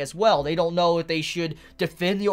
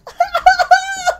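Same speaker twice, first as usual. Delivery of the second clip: shrieking delighted laugh, much higher; real burst of high laughter; 9 dB louder than usual. Real, not acted. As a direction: lose it completely at the panel. Delighted reaction to Furuta's face.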